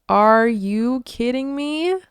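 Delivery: exasperated. Acted. Morris cancels the date.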